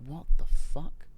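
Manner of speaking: loud startled what the fuck; mock horrified shout; shocked yell. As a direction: blurt it out in shock. Shocked at missing body part.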